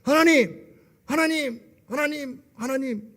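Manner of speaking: high repeated cries to God, fading; insistent calling. Shown as a demonstration. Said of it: "그냥 계속". (높은 목소리로 계속 부르짖듯, 점점 잦아들게)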